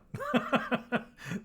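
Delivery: high chuckle in short bursts; rueful laugh. Real, not acted. Reacting to a viewer's bad news, rueful laugh. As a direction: laugh in quick, high-pitched pulses. Reacts to governor's tragic decision.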